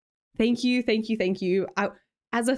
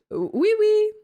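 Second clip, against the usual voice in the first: mock French; 10 semitones higher, 4 dB louder than usual. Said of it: "can you say a sentence in French?".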